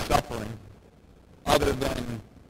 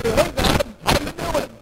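casual greeting to God; 10 semitones higher, 7 dB louder than usual. Retold or imitated. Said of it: "and say".

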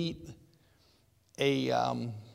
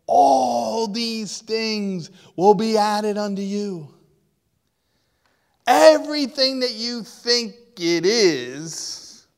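louder, slow, voice close to crying; tearful preaching; 6 semitones higher, 8 dB louder, slower than usual. Real, not acted. Preacher promising the kingdom, slow tearful loud. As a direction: let the emotion break through as you preach. Sermon climax, cry heard.

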